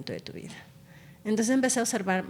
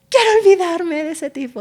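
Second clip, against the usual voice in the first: much higher and louder, playful mock lament; mock heartbroken girl; 11 semitones higher, 12 dB louder than usual. Doctor mimics a heartbroken patient, hammed-up. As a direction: ham up a jilted girlfriend. Acted heartbroken girlfriend quote.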